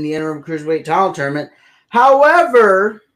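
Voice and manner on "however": drawn out, high and loud; dramatic high however. Real, not acted. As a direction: land the twist big. Dramatic twist in recap.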